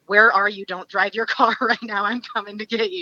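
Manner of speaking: lightly acted alarmed friend taking charge; mildly urgent. Best friend phoning a panicking caller, mildly urgent.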